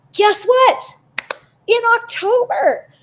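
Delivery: high and loud, bursting with news; thrilled guess-what build-up; excited announcement. Real, not acted. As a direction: tease the big reveal. Big announcement to viewers.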